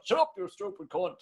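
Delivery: a hint of a rough farmer scolding his boy; farmer shouting. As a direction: bark it lightly like an old farmer. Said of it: "like a farmer shouting at his young fella".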